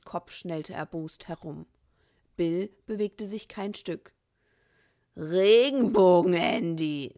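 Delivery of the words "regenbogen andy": loud, slow gangster drawl of Regenbogen; slow and menacing. A narrator's reading. Bill repeating the mockery at Andy, slow and loud. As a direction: draw it out slowly as a threat. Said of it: "sagte er langsam".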